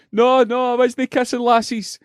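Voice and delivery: put-on high childish protest; wee boy protesting. Boy denying to shop worker he kissed lassies, high childish voice. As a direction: deny it like a wee embarrassed boy.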